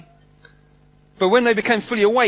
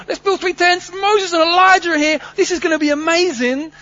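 higher and louder, thrilled Peter; excited Peter; 9 semitones higher, 5 dB louder than usual. Acted. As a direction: Peter gushing over the tents. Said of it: "Peter's the same".

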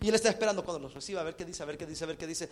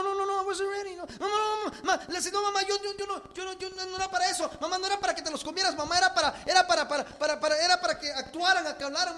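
high, loud desperate pleading, no no no; a son horrified his mother ate the talking birds; panicked high-pitched begging; frantic son; 10 semitones higher, 4 dB louder than usual. Acted.

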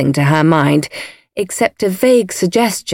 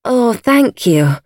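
higher, slow, cowed thanks; meek lady; 6 semitones higher, slower than usual. A narrator's reading. Lady Coote to her severe gardener, meekly. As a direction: thank him meekly, drawn out.

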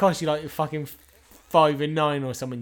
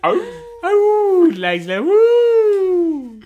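very high loud whoop; excited bingo crowd; 9 dB louder, much slower than usual. Retold or imitated. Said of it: "They know all the fucking".

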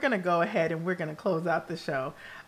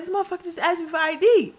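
higher and fast, incredulous; indignant outrage; 10 semitones higher, faster than usual. Retold or imitated. Telling husband the delivery guy asked for ID, indignant and fast. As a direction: rattle it off, offended and worked up.

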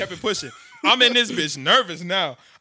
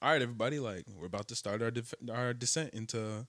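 lightly voiced pilot, low and soft; calm pilot announcement; 6 semitones lower, 10 dB quieter than usual. Captain announcing descent to passengers, lightly imitated calm. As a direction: read it like a bored captain on the intercom. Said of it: "The pilot goes".

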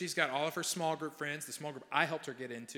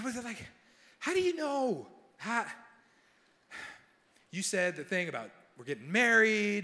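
higher, rising alarmed questions; pitched-up imitation of a shaken girlfriend; upset girlfriend; 6 semitones higher than usual. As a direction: voice her flustered and worried.

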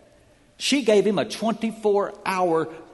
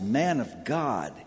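softer, slow humble plea; begging; 6 semitones lower, 5 dB quieter, slower than usual. Acted. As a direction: plead for your life. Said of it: "he begged".

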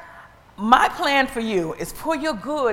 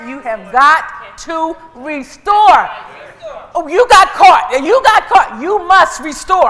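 loud, insistent demand; punchy preacher's challenge to the devil; forceful preaching; 6 semitones higher, 13 dB louder, slower than usual. Acted. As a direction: lay down the law from the pulpit.

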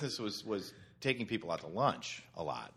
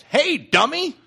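much louder, higher jeering call; 14 dB louder, slower than usual. Retold or imitated. Coffee shop regulars calling out to a man, loud. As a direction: hail him across the coffee shop.

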